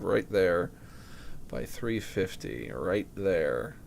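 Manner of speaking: ordinary talk, nothing marked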